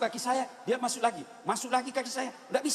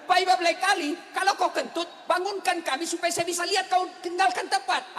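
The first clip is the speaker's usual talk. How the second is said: higher-pitched mock protest; acted complaint; 7 semitones higher than usual. Congregant scolding the imam who left, higher mock protest. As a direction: raise the pitch and press the grievance.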